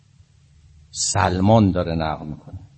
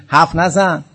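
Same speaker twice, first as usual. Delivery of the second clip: high, loud don't-talk order; retold shout; 8 semitones higher, 6 dB louder than usual. Preacher voicing Omar ordering the Imam silent. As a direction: snap it out loud as the arrogant caliph.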